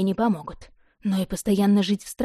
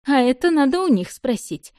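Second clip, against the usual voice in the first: higher, louder playful quip; cheerful smirk; 6 semitones higher, 5 dB louder than usual. Acted. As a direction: toss it off with a merry snort. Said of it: "весело хмыкнула Мерида". (весело хмыкнув, выше и громче)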